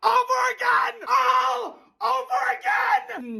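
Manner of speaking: high, slow screamed wail; screaming wail. Acted. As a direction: drag out a desperate scream. Daily scream rant.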